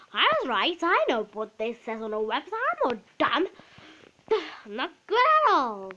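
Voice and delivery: indignant pupil impression. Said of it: "That was amazing".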